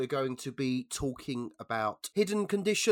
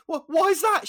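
very high, loud mock alarm; alarmed postman; 10 dB louder than usual. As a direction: squeal the question in comic panic. Postman's alarmed question in joke.

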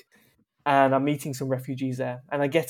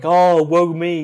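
mock self-pity; 4 semitones higher, 9 dB louder, slower than usual. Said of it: "And I just felt like".

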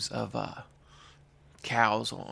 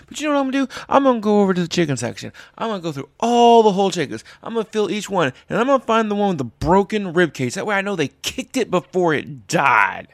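loud, higher mock-menacing rant; loud spiteful rant; 7 semitones higher, 8 dB louder than usual. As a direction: build it into a gleeful threat. Mocking rant at PETA guy.

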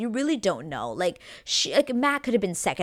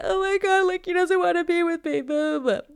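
whiny mock sobbing, much higher and louder; mock crying; 11 semitones higher, 5 dB louder than usual. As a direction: imitate her crying over a guy, hammed up. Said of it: "She's crying and she's like".

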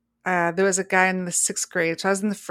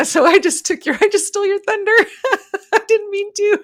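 giggling through the confession, high; laughing; 13 semitones higher, 8 dB louder than usual. Real, not acted. Host confessing she stole Brenda's thunder, giggling high. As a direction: laugh it out, embarrassed. Admits stealing cohost's idea, laughing.

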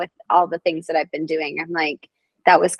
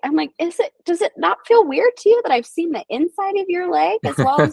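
pitched up, uneasy politeness; student nurse staring at a healed stump, awkwardly high; 10 semitones higher than usual. Retold or imitated. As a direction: squeak it out, a bit uncomfortable. Awkward retold reply.

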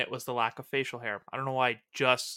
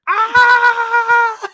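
long, high, loud scream; imitated Thor yell; 14 dB louder than usual. Fan imitating Thor still yelling into the pub, long loud scream. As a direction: hold a drawn-out yell at full volume. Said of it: "he's still yelling".

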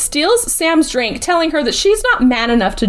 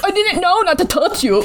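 higher mock-innocent voice; laughing mimicry, pitched up; mocking excuse; 5 semitones higher than usual. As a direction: lightly parody her feeble excuse.

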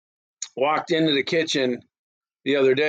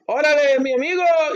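high, loud hammed-up Spanish hail; exaggerated amigo greeting, pitched way up; mock Spanish greeting; 12 semitones higher, 5 dB louder than usual. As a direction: call it out high in put-on Spanish. Acted Spanish greeting to a Mexican.

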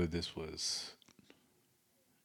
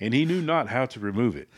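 raised, louder mock-preacher delivery; pastor voice; 4 semitones higher, 5 dB louder than usual. Co-host continues sandwich verse, raised mock pastor voice. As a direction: preach the woe like scripture. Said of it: "do it in your best pastor voice".

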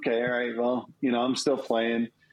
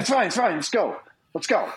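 high rushed voice; imitating a rushed brother; hurried brother; 7 semitones higher than usual. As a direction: brush it off in a hurry. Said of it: "he's like".

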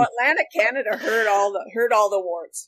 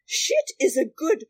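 imitating Margaret Visser; much slower than usual. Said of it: "she said".

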